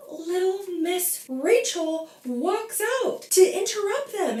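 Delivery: mock-sweet scorn; sarcastic. Real, not acted. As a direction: sneer at her entrance. Sarcastic retelling.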